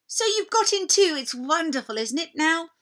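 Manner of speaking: bubbling over with delight; Lucy. A narrator's reading. Lucy thrilled to find Edmund in Narnia, excited.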